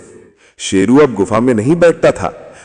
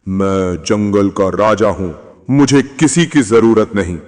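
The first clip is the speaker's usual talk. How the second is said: proud lion king; 6 semitones lower than usual. A narrator's reading. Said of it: "Sheru हमेशा कहता था".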